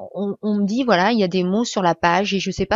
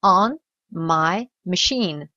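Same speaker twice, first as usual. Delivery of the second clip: "on my machine" drawn out very slowly; very slow model phrase; much slower than usual. Shown as a demonstration. Teacher slows down on the last segment. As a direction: stretch every word.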